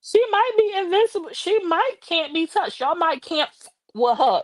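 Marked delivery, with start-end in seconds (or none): none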